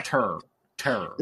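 low, swallowed "Terror"; slurred one-beat terror. Acted. Mimicking how "Terror" gets said, low and slurred. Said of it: "That's what you say".

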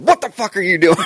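startled mock shout; startled yell. Startled by daughter in the dark, loud shout. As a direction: yell as if grabbed by a hand in the dark. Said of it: "I immediately said".

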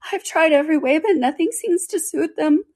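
high-pitched plaintive wail; half-sobbing. A narrator's reading. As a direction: complain high, with a kind of sob. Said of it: "added with a kind of a sob".

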